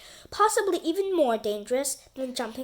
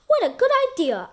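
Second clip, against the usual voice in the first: high, loud and fast delight; chirpy burst of enthusiasm; 12 semitones higher, 7 dB louder, faster than usual. Acted. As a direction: gush over the idea. Delighted agreement.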